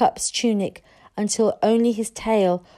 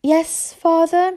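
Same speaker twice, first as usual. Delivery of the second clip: admitting; 9 semitones higher, 4 dB louder, slower than usual. A narrator's reading. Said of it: "Hiccup admitted".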